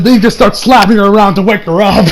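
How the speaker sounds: normal talking voice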